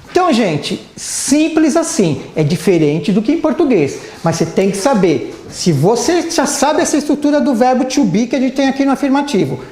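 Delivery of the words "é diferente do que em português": normal talking voice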